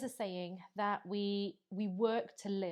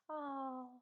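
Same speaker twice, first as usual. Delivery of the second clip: mock sympathy; 5 semitones higher, 5 dB louder, slower than usual. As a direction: imitate a head-tilt show of pity. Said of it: "it's the head tilt, isn't it?".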